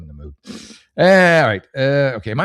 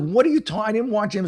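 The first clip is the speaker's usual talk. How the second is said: retold indignant protest; 10 semitones higher than usual. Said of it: "And I'm like".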